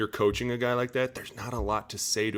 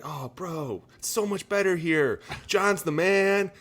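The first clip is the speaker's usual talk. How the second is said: high, bubbly recruiting bro voice, climbing higher; mock Niners recruiter; 11 semitones higher, 7 dB louder than usual. Player mocking Niners players luring a new teammate, high bro voice. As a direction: oversell the team like a chill bro. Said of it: "they're like".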